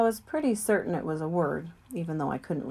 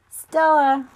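louder, higher call of a name; 8 semitones higher, 10 dB louder than usual. Acted. Ivan calling out to Stella at night, louder and higher. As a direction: call her name across the dark.

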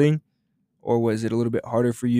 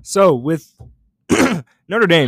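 speaking through a tickly throat; throat needing clearing; 5 semitones higher, 9 dB louder, slower than usual. Real, not acted. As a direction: talk right after clearing the throat. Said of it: "I'm sorry, I have to clear my throat".

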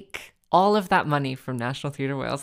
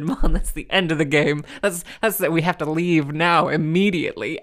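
loud, mock dramatic declaration; loud declaring; 6 dB louder than usual. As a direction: announce it like a verdict. Declaring game over to mom.

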